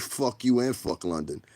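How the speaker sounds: ordinary talk, nothing marked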